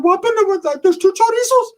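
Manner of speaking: very high squeaky questioning; excitable Ricky impression. Ricky asking about two chorizos, very high squeaky voice. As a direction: squeal it in cartoonish disbelief. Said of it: "Ricky's like".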